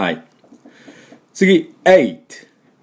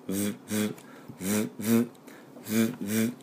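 buzzing V sound; 14 dB quieter than usual. Shown as a demonstration. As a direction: buzz like a phone on vibrate. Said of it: "携帯バイブのように振動させます". (唇を震わせる小さなVの音)

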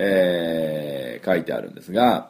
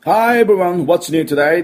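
cheery show opening; 10 semitones higher, 6 dB louder, slower than usual. Acted.

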